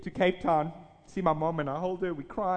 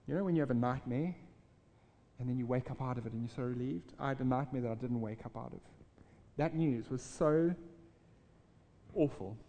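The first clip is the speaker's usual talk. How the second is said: quieter, lower, heavy with loss; real grief; 5 semitones lower, 6 dB quieter than usual. Real, not acted. Preacher remembers his brother's death, real quiet grief. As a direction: let the sorrow sink the voice down.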